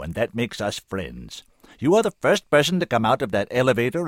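loud, commanding oratory; full-power voice. A narrator's reading. Senator urges the woman not to give up, full-powered. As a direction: press each point home with full force. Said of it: "using the full power of his voice now".

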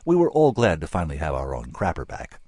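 ordinary delivery

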